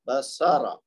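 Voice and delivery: بسارا with a clean, crisp س in the middle; careful pronunciation. Shown as a demonstration. Teacher models بسارا with the س cleanly articulated. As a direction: say بسارا so the س sound is exact. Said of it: "سین کی آواز صحیح مخرج اور صفت کے ساتھ ادا کرنی".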